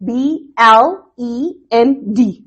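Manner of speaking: slow spelling out, letter by letter; letters pronounced distinctly. Shown as a demonstration. Teacher spelling an example word for students, slow and distinct. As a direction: say B, L, E, N, D one at a time. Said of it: "Each word is pronounced distinctively".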